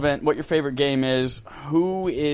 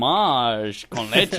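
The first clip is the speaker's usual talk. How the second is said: slow, mock French accent; hammed-up French accent, drawn out; 3 semitones lower, much slower than usual. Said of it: "in Spanish in a French accent".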